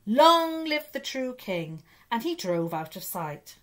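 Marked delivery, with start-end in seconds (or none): 0.1-1.8 much higher, louder, slow festive cry. A narrator's reading. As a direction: boom it out like Father Christmas leaving. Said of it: "he cried out".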